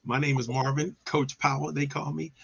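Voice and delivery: ordinary delivery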